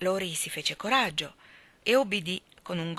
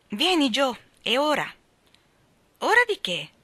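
calling out; 7 semitones higher, 6 dB louder than usual. Acted. Sisters calling, quarrel starts.

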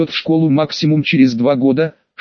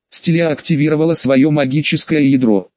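usual speaking voice

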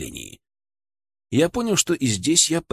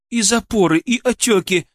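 high, louder, upset cry; voice quivering towards tears; 9 semitones higher, 4 dB louder than usual. A narrator's reading. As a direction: confirm it in distress, close to tears. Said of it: "Её голос дрогнул от подступающих слёз". (взволнованно, голос дрожит от слёз)

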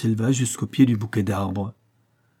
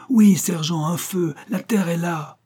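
higher, thrilled announcement; excited; 7 semitones higher than usual. A narrator's reading. Lieutenant announcing land to the sergeant, excited. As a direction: tell the sergeant land is near, elated.

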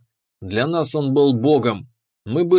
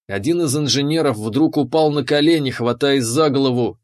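ordinary delivery, nothing marked

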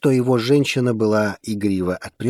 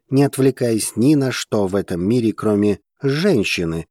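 plain reading voice

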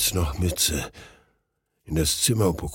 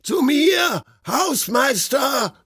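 very high, slow desperate yell; heartrending scream; much slower than usual. A narrator's reading. Friend screaming down the stairwell for the caretaker. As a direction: drag out the call for the caretaker. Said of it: "herzzerreißendes Geschrei".

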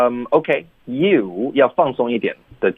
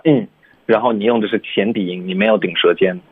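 normal talking voice

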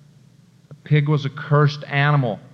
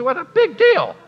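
much higher mock whining voice; mocking whiner; 10 semitones higher, 3 dB louder than usual. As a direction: sneer it like a sulking critic. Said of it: "You say".

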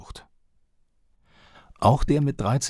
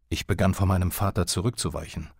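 ordinary talk, nothing marked

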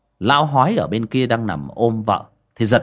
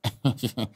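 sheepish chuckle; 7 dB quieter than usual. Acted. Sheepish culprit laughs.

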